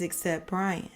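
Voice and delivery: usual speaking voice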